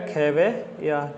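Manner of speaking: खेवैया very slow, split before the last syllable; slow syllable breakdown. Shown as a demonstration. Teacher answers own spelling question, sounding out slowly. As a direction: draw खेवैया out in parts, falling. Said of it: "किसका क्या आएगा".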